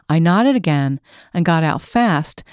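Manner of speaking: plain reading voice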